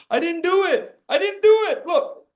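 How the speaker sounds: put-on high, pleading kid voice protesting innocence; child's high whiny denial; whiny little boy. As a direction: play a boy denying he did it. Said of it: "when the young boy says".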